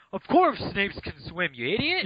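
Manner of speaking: high mocking scold; exasperated outburst. Real, not acted.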